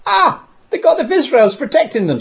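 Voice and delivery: a retold soldier's startled outcry; panicked cry. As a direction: cry out as the trap closes, in mock alarm. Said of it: "And then they say".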